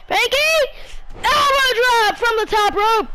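excited high play-by-play calls; wrestling commentator. Acted. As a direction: call the match like a commentator.

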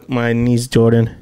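ordinary delivery

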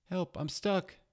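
high, loud frightened cry; panicked cry for help. Acted. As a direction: cry out, scared and trapped. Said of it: "cries a pufferfish".

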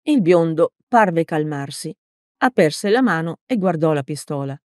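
ordinary delivery

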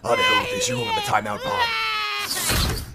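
high, frustrated rant over wailing; exasperated yelling. Acted. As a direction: yell at a crying child in exasperation. Exasperated babysitter over a crying child.

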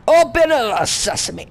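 high, called-out incantation; mock magic command. Player commanding a game door to open, high mock incantation.

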